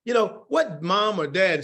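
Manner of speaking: normal talking voice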